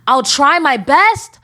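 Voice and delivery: hammed-up imitation of a weak promise; mocking the boyfriend. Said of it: "His response ... was".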